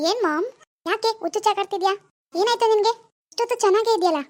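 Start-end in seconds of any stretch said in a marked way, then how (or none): none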